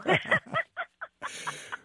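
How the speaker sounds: delighted laugh up high; high bright laugh. Real, not acted. Guest laughs, delighted by the flattering intro. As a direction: burst into a happy laugh.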